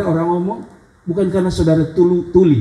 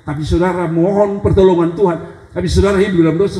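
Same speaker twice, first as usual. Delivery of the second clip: ordinary talk, nothing marked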